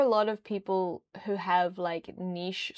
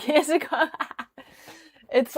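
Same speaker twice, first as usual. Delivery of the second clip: ordinary talk, nothing marked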